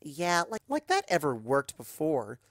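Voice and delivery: put-on dismissive teen scoff. Daughter scoffing at her father, dismissive. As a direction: brush it off. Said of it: "she scoffs".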